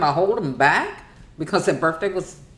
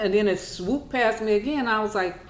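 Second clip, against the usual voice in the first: normal talking voice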